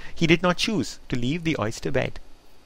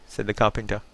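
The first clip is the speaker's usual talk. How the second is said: ordinary delivery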